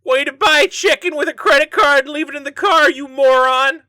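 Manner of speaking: high, loud jeering cop voice, sneering at a suspect; mocking cop. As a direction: cop sneering at the suspect over his chicken receipt, jeering loudly. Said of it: "being like".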